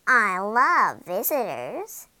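slow, loud, swooping witch voice; old woman. A narrator's reading. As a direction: draw it out with greedy relish. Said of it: "said the old woman".

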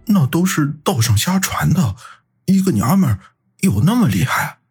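high-pitched, meek doubt; timid. A narrator's reading. Qi Bing doubting the rumour to his boss, timid. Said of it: "骑兵诺诺地说".

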